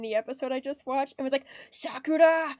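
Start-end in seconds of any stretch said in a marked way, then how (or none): 1.7-2.6 lightly imitated gangster girl's hard accent; a touch louder, mock-tough anime name call; Saki. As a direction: imitate a delinquent calling out a name. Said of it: "hard, like, accented voice".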